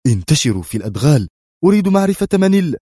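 urgent command rising high and loud; sharp, angry order. A narrator's reading. Commander orders his men into the jungle, sharply. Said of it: "فقال في حدة".

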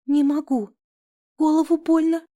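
high, whimpering mock-helpless voice; acted sob, pitched well up; sobbing. A narrator's reading. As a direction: whimper it high, like a hurt girl. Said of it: "всхлипнула я".

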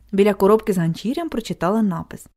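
plain reading voice

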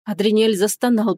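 plain reading voice

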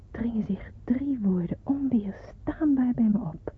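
usual speaking voice

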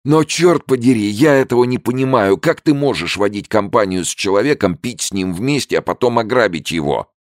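high, loud indignant shout. A narrator's reading. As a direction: cry out in disbelief. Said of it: "крикнул Эл". (громкий возмущённый крик)

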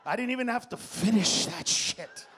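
ordinary talk, nothing marked

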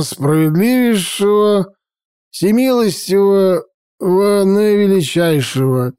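slow, high public proclamation, slurring; drunk town crier. Acted. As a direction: announce it like a drunken herald. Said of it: "заголосил, как на публичной казни".

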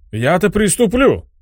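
high, slow squeaky gnome voice. Acted. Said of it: "пропищал гном".

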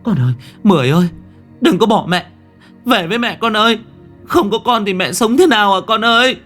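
loud, high pleading wail; desperate mother crying out at high pitch; anguished scream. A narrator's reading. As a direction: beg your child not to leave, screaming. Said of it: "Hiền gào lên".

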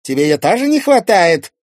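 gloat, then needle him with a question; spiteful Irina. Acted.

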